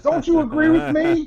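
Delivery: very high, loud laughing shriek of a demand; hammed-up furious customer, cracking up; mock angry shopper. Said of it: "Don't go bananas".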